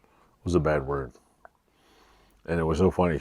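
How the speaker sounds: ordinary talk, nothing marked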